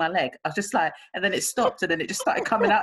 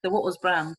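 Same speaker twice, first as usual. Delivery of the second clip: ordinary talk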